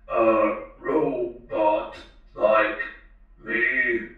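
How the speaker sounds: very slow, toneless robot question; mechanical robot voice. A narrator's reading. The talking robot questions the girl, slow mechanical voice. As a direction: stretch each syllable out like a machine. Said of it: "a mechanically timbered voice".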